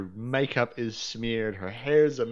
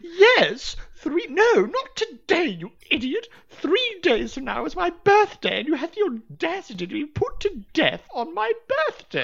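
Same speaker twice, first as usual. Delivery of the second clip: much higher, much louder whine; shrill noblewoman scolding; 5 dB louder than usual. Acted. Noblewoman blames Anya, high whining. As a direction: whine it at her like a spoiled lady.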